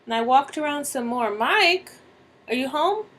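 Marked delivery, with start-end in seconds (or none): 1.3-3.1 higher-pitched questioning call; searching call with a rising end. Acted. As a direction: call out to see if someone's home. Calling through house for husband.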